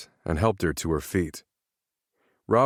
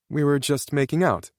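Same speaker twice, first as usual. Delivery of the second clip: put-on girl character voice; 8 semitones higher than usual. A narrator's reading. Narrator voices Brooklyn.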